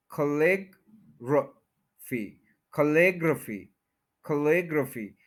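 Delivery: खलेग्रफी as slow kuh-LEG-ruh-fee; stress on LIG. Shown as a demonstration. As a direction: break down खलेग्रफी slowly, weight on LEG. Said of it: "लिग के ऊपर पूरा stress है".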